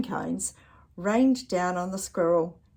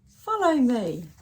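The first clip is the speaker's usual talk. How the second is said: calling out; 4 semitones higher, 6 dB louder, slower than usual. Acted. Squirrel leads across.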